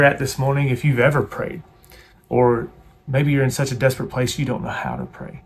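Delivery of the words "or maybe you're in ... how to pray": normal talking voice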